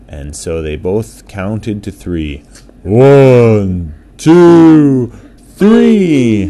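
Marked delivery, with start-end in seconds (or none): 2.8-6.4 rising, louder with each number; excited countdown. A narrator's reading. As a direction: build the count up to the start. Counting down to the race.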